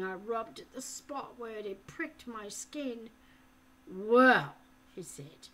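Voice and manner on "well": loud, slow, hollow threat; harsh interrogator voice; menacing captor. A narrator's reading. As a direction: bear down on him with each word. Falconer decides.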